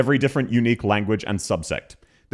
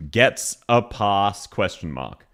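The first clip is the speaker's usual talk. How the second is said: very slow, spaced-out words; dictating a label; 6 semitones lower, much slower than usual. Real, not acted. Streamer typing a new tier name, slow dictation. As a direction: sound it out slowly, dropping low at the end.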